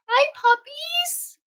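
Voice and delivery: high, slow sing-song to the puppies. Real, not acted. Greeting newborn puppies in high baby talk. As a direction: coo at them like babies.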